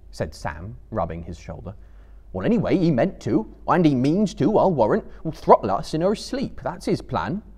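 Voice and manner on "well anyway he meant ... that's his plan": a louder, higher, indignant hobbit voice; gruff grumbling Sam. Acted. Sam arguing with Frodo about Gollum, indignant. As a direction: insist darkly he meant harm.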